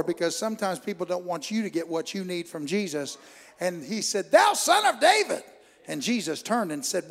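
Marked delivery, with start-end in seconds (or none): 4.3-5.4 high, loud desperate cry; crying out louder. Acted. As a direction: cry out to Jesus with all you have. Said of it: "he cried the louder".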